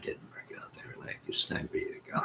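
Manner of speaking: soft hushed whisper; whispered aside. Retold or imitated. Telling viewers the hospital kicked him out, whispered. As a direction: lean in and whisper the punchline. Retelling being sent home.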